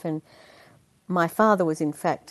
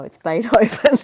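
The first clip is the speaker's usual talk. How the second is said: bright, louder schoolkid reply; kid's answer; 6 semitones higher, 8 dB louder than usual. Retold or imitated. The kid at school asked about pop groups, proud answer. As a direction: proudly announce your odd favourite like a child. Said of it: "I'd say".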